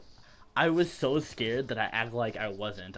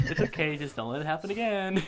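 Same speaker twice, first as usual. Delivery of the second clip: mock lenient teacher; 6 semitones higher, 3 dB louder than usual. Imitating the lenient teacher forgiving the thrown pencil. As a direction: play the pushover teacher sweetly.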